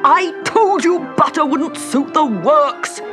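high, loud, slow reproach; angry Hatter. A narrator's reading. The Hatter blaming the March Hare, angry. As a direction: hammer out each word crossly. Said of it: "looking angrily at the March Hare".

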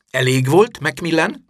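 sharp reprimand. A narrator's reading. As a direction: tell a pupil off firmly and loudly. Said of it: "szólt rá McGonagall". (hangos, emelt hangú rendreutasítás)

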